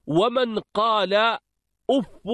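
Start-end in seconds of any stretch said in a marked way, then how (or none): 1.9-2.3 أف said "uffu", short u after doubled f; damma ending. Shown as a demonstration. Teacher contrasting forms for students, أف as "uffu". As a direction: end أف on "-fu". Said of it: "جعله بمنزلة مد".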